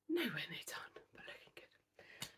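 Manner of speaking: barely audible whisper; hushed, voiceless confiding; whispered aside. Real, not acted. Confiding about a secret project.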